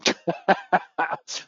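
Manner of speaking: real laugh. Real, not acted. Laughing with Mark at the cash is trash joke, a real laugh.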